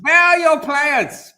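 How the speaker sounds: high, slow mock German officer; bad German accent. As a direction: ham up a German guard barking the question. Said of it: "Poor German accents".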